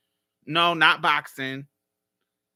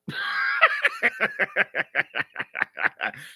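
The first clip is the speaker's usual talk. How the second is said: high laugh; 8 semitones higher than usual. Real, not acted. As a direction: crack up at your own joke, high. Host laughing at own crude clue.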